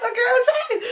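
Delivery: high, loud squeal through laughter; laughing protest. Real, not acted. Learner listing every Spanish word she knows, laughing protest. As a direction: protest while cracking up.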